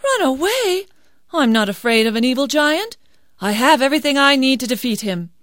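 put-on high, bold girl's voice, fast at the end; a confident young heroine; brave daughter. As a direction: scoff at fear. Daughter defiant.

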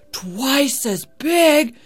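gasping, drawn-out awe, climbing high and loud; greedy amazement. A narrator's reading. Labai hears of a bigger feast, greedy amazement. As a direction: savor each word, slow and greedy. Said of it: "Labai thought greedily".

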